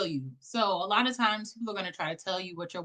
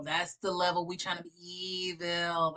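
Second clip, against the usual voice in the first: normal talking voice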